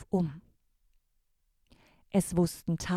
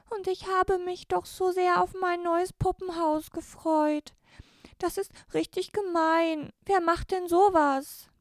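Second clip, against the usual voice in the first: put-on high, whiny girl's voice, loudest at the end; upset girl; 10 semitones higher, 3 dB louder than usual. Jasmin laments her dollhouse, high upset girl's voice. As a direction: complain it's so unfair. Girl complains about lost gift.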